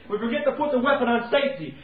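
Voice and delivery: ordinary delivery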